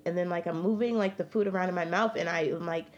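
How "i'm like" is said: ordinary talk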